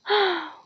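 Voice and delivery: a long tired exhale before speaking; weary sigh. A narrator's reading. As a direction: sigh at the endless homework. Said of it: "Ron sighed".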